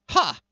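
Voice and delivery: gloating villain scoff; scornful ha. Acted. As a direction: bark a short sneering laugh.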